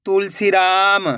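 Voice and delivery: slow, stretched reading of the whole word; joined-up word. Shown as a demonstration. Teacher showing learners the finished word, slowly joined up. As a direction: blend the syllables into one drawn-out word. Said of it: "तो मिलके बन जाएगा".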